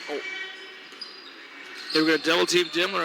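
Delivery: usual speaking voice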